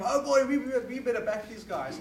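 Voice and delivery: loud, high mock enthusiasm; mock naive Westerner. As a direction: ham up a gullible American cheering on the rebels. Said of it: "when they hear about people fighting for freedom".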